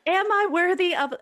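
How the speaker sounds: high, loud mock Southern belle lament; Southern accent. Host joking about doubting her own worth, hammed-up Southern accent. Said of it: "this is where I break into my Southern accent".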